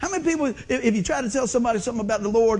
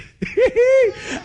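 burst of high laughter; 14 semitones higher, 8 dB louder than usual. Real, not acted. Laughs at his own conversion story.

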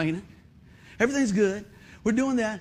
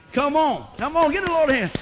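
louder, higher call to the crowd; rallying call; 6 semitones higher, 6 dB louder than usual. Real, not acted. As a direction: pump the congregation up for applause. Preacher urging applause.

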